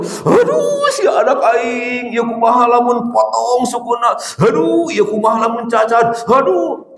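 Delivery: high, sing-song wailing fret; panicky mother. Retold or imitated. Mother fearing her child's leg is lost, high sing-song wail.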